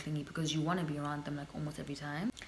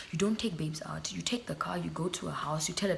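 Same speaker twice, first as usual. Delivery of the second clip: whisper. Real, not acted. Whispered complaint about men.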